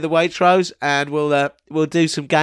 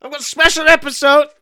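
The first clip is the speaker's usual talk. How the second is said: squeaky character voice; 11 semitones higher, 5 dB louder than usual. Pretend. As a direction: bellow it like a shrill gremlin. Voicing Timmy Biscuits.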